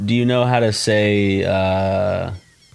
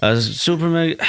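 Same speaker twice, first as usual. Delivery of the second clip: mock disappointed Russian man; deflated, forced promo; 7 semitones higher than usual. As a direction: sound let down saying the channel name. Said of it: "He's just really disappointed".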